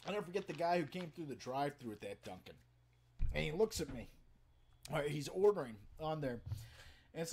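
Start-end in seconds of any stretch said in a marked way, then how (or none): none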